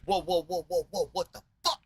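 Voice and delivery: high, laughing mock panic "whoa, whoa"; freaking out. Moses shocked at returning army, high laughing alarm. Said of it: "He's freaking out".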